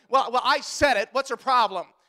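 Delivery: put-upon, exasperated husband act; defensive husband. Acted. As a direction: protest like a husband who's been corrected. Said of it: "You didn't mean it".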